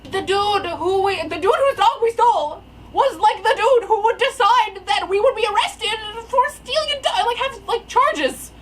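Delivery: high, loud, half-laughing wail; incredulous squeal verging on laugh-crying; squealing disbelief. Real, not acted. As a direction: repeat it in hysterical disbelief. Repeats fact in squealing disbelief.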